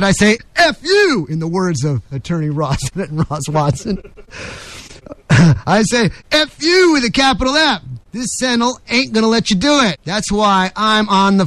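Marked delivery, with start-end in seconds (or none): 0.6-1.3 high-pitched, punched-out defiance; defiant outburst. Acted. Host to those changing our way of life, defiant outburst. 6.3-7.8 high-pitched, punched-out defiance; defiant outburst. Acted. Host to those changing our way of life, defiant outburst.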